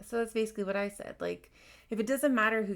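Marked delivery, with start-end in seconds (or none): none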